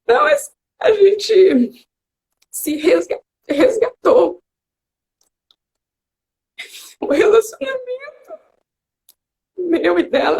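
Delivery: real tearful voice, high and halting; choked up, crying. Real, not acted. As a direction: fight through tears with long pauses. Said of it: "eu fico até emocionada".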